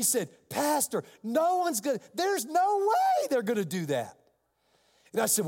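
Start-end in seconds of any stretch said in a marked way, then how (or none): 0.5-4.2 imitating an exasperated attorney, high and insistent; higher pitch, pleading in disbelief; retold outburst, pitched well up; incredulous protest.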